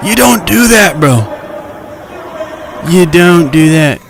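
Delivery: loud, high shocked shouting; real shout. Real, not acted. Vlogger watching a mall fight, shouting loud and high. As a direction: yell at the screen in disbelief.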